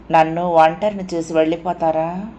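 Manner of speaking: plain reading voice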